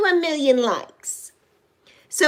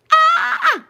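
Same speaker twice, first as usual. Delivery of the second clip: short, very high shriek of joy; excited squeal; 4 dB louder than usual. Real, not acted. [name] is in the building, excited squeal. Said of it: "I'm excited".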